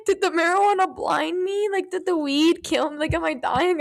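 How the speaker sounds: high, mock-terrified questioning; scared. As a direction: re-enact rising panic about your own body. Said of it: "'Cause I was so scared".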